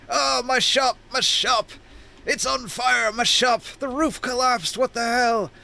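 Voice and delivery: high-pitched panicked wailing; Grick. Acted. Shopkeeper inside his burning weapon shop, high panicked wail. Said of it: "Grick is inside screaming".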